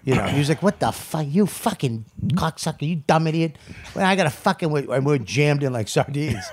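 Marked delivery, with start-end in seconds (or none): 0.6-4.9 retold angry rant, not shouted; Nick DiPaolo, angry. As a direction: imitate an irritated friend's cursing tirade. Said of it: "Nick DiPaolo just got angry".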